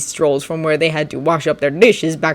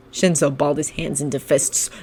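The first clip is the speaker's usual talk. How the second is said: ordinary delivery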